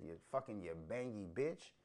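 ordinary delivery

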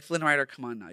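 ordinary talk, nothing marked

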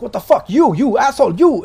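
high, loud, outraged yell; a guard shocked to find his enemy at the door; shocked angry shout. Acted. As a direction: spit it out in shock and rage. NPC grapples Tomcat, shocked.

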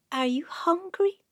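high, slow coaxing mother; mother's voice. Acted. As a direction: sweetly coax the baby. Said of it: "said the mother".

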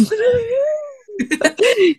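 high shrieking laugh. Real, not acted. Scared of basement, laughing.

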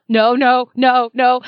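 rising panic, out loud; audible no. Retold or imitated. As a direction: say it out loud in dismay. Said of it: "it went to an audible".

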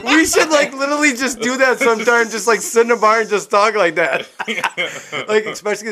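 high, loud, talking through real laughter; helpless laughing talk. Real, not acted. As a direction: crack up while pitching an idea. Cracking up at the group's bit.

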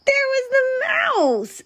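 high, wailing surprise; storyteller's high cry of disbelief; dismayed discovery. A narrator's reading. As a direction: wail it in horror. Narrator's dismay at final discovery.

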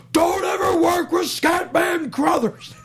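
high, slow furious shout; erupting Kubrick. Retold or imitated. Kubrick erupting over the phone with a high, slow shout. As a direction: explode at him down the phone, slowly. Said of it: "Stanley erupts from across the ocean".

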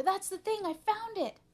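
high, loud triumphant cry; screaming. A narrator's reading. Arthur finding the thing at last, screaming. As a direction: exclaim it at the top of the range. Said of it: "screamed Arthur".

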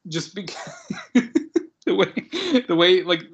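high, laughing restarts; real giggles pushing the voice up and loud; laughing while talking. Real, not acted. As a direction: burst out laughing, then struggle to start the sentence. Cracking up over a favorite scene.

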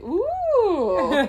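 high, loud impressed ooh. Retold or imitated. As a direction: coo with amazement. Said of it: "both the girls are like".

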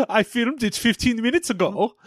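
comic German actor digging in; German accent. Acted. Actor saying it is already filmed, hammy German accent. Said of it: "try out my German accent".

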